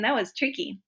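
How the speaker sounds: ordinary delivery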